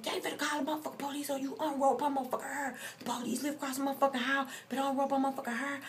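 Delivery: retold yelling. To the friend pulling hair, a fast, high yell. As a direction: rush it out, pleading for her to let go.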